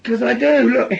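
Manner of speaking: a high, loud snap back; sharp outburst. Acted. Man insisting to his friend that he wants the gun, loud and sharp. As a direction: bark it back defensively.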